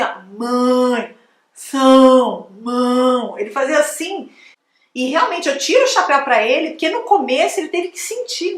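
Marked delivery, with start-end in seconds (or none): 0.0-2.5 mão said as mãe then são, mimicking a learner; imitating nasal mão. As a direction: copy the learner's wrong nasal vowels. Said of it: "ele vinha assim". (imitando o aluno errando mão)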